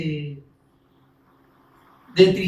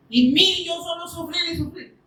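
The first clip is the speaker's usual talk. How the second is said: lamento fingido; 10 semitones higher than usual. Retold or imitated. Imita lamento de sufrimiento.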